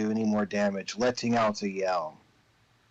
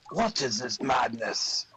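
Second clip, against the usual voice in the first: chieftain voice; slower than usual. Acted. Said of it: "he says".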